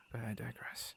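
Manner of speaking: a soft whispered self-correction; whispered aside. Acted. Dropping the dark topic.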